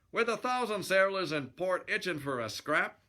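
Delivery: lightly acted fighter's protest; annoyed boxer. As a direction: gripe at the pest picking a fight. Boxer hero's annoyed retort.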